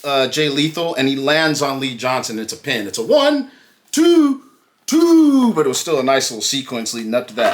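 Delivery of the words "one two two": high, loud drawn-out count; referee's pin count. Retold or imitated. Host retelling a pinfall attempt, high called-out count. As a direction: count it out like a referee at a near fall.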